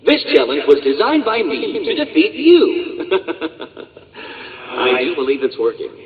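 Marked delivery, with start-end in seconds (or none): 3.1-3.8 a short breathy laugh at his own cleverness; smug villain chuckle. Acted. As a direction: chuckle under your breath, pleased with yourself. Villain taunting the Dark Knight.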